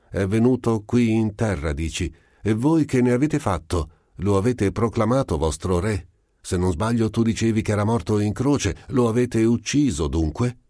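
voce aliena più alta, ironica e incalzante; il marziano incalza il prete con domande pacate; alieno ironico. A narrator's reading. (marziano beffardo che incalza il prete)

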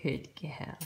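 ordinary delivery

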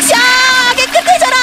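high chanted incantation; mock magic spell. Casting a cleaning spell. (주문 외우듯 높게)

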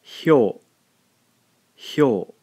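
"hyo" as one syllable, clear and stressed; Japanese hyo. Shown as a demonstration. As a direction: pronounce "hyo" slowly as a model. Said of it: "gives us the sound".

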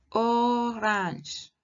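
"Orange" again in French, nasal ending, slow and clear; French orange repeated. Shown as a demonstration. Teacher asks the class to repeat, clear French model. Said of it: "Vous pouvez répéter?".

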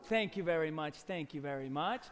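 low, soft murmur; low mock-modest thanks. Magician thanks the audience for a tiny trick, low and soft. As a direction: drop right down, humbly accepting applause.

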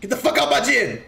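high, put-on tough-guy yell; mock gym owner. Streamer taunting a survivor, hammed-up tough-guy voice. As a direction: bark them out of your gym, hammed up.

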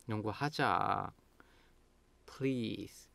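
soft, lingering plea; pleading. Shown as a demonstration. Begging a friend to play basketball, pleading. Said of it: "Now you are pleading".

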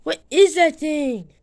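ordinary delivery

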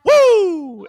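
high, loud wrestler's whoop; sudden shrieked catchphrase whoop; Ric Flair whoop. Retold or imitated. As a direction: let out a piercing showman's "woo". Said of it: "Ric Flair".

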